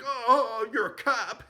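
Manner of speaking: excited squeal. Retold or imitated. Zed unmasking undercover cop.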